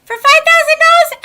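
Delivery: high, loud incredulous squeak; indignant disbelief. Real, not acted. Scoffing at a five thousand dollar fee, high and loud. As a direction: pitch it way up in mock outrage.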